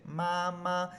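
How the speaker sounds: "mamá" as two long level syllables; drawn-out, even "mamá"; two full first tones. Shown as a demonstration. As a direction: hold MA and MA equally, no drop. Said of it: "no se dice mamá".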